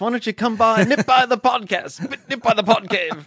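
a cheeky British come-on, hamming it up and laughing; put-on British accent. Cohost flirts with caller Tracy in mock British accent.

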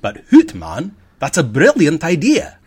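exaggerated Scottish impression of the restaurant boss; Scottish accent. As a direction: burst into a broad Scottish accent for "hoot". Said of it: "I guess I should do the accent".

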